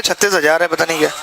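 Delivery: usual speaking voice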